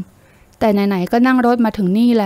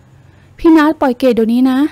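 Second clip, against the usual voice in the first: slow, louder, a little higher plea to let go; panicked cry; 3 semitones higher, 6 dB louder, slower than usual. A narrator's reading. Girl grabbed at the waist by him, panicked cry. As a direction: cry out in alarm to be released. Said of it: "ร้องอย่างตื่นตระหนก".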